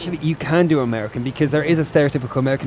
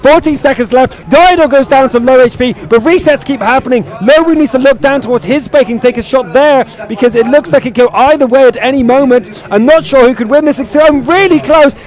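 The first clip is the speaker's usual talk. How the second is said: raised, tense running commentary; live esports play-by-play; 9 semitones higher, 8 dB louder than usual. Real, not acted. Caster calling a possible double cap, mounting excited play-by-play. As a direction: build urgency toward the final seconds.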